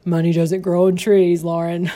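lightly voiced father scolding, mock lecture; dad's saying. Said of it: "I remember my dad saying often".

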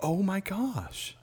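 high, slow, soft exclamation; mock disbelief. Real, not acted. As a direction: draw it out in high-pitched, playful disbelief. Teased about being embarrassed by accent.

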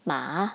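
"ma" low, dipping then rising; falling-rising third tone. Shown as a demonstration. Teacher running through tones, "ma" dipping low. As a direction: dip "ma" deep and back up. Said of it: "Third tone".

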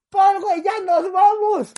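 loud and high, slow, pressing; urgent call. Retold or imitated. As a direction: hurry a friend off the bus. (llamado urgente a gritos)

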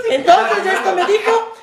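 hammed-up nervous friend reading aloud; imitating nervous Cacas. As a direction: read it like a jittery friend on stage. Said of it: "el Cacas era así".

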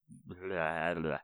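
low, slow, throwaway blablabla; dismissive blabla. Real, not acted. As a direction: brush the words aside. Skipping over her own slip.

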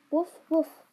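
playful, punchy imitation barking; mock dog bark. A narrator's reading. Lorenz teases Fritz with barking.

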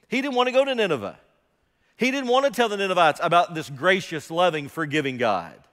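loud, high, fast burst of conviction; impassioned preaching. Real, not acted. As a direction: drive the point home hard. Preaching at full force.

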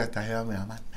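soft unvoiced whisper; whispered worry. Singer recalls fearing the host would wear him out, whispered. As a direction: whisper it like a secret fear.

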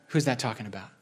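ordinary talk, nothing marked

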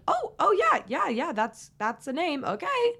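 high-pitched, hesitant fake agreement; mom's baffled reaction. Mom hearing a name she can't pronounce, baffled and high. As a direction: play a mom pretending she got it. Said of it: "something my mom would react when hearing a name that she can't pronounce".

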